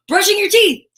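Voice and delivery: thrilled reveal of brushing teeth; excited reveal. Acted. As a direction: announce it like a surprise treat. Dramatic reveal for kids.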